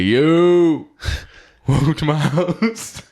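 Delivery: high, slow party host greeting. Retold or imitated. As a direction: greet them like a loud host. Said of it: "He's like".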